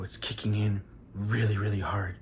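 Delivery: soft and low, words dragging a little; hushed drugged murmur. Retold or imitated. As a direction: keep it secret, but you're fading. Drugged, telling wife in line.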